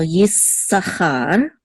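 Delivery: "Yisachar" slowly, with a long S; held S. Shown as a demonstration. Teacher models the name, "Yisachar" slow with doubled S. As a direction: draw out the S in "Yisachar", then land on char. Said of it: "just hold it a little bit".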